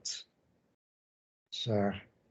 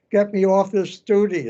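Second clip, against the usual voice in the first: higher, louder, a tearful plea to be relieved; pleading through tears; 6 semitones higher, 4 dB louder than usual. Retold or imitated. Sailor begging the commander to end his guard duty, tearful. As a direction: beg to get out of it, voice near breaking. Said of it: "And I'm crying".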